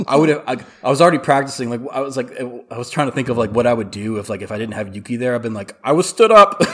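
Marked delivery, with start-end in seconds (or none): 5.8-6.6 loud, high announcement to the room; hammed-up wail of embarrassment; mock shouted excuse. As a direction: protest it loudly for everyone to hear. Acting out loud public excuse.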